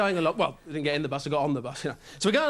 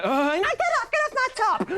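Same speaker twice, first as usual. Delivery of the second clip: much higher, squealing protest; girlfriend's squeal. Retold or imitated. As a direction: squeal at your boyfriend to stop. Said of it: "He goes".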